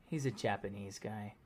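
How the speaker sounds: "He's a Japanese guy" dropped low and quiet, let down; disappointed, dismissive. Shown as a demonstration. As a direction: sigh out "Japanese guy" low and soft with disdain. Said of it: "he's disappointed".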